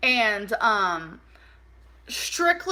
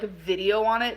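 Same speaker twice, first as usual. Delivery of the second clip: ordinary talk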